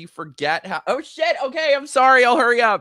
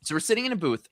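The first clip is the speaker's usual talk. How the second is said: ordinary delivery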